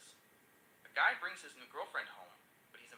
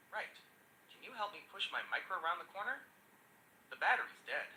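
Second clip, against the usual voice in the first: ordinary talk, nothing marked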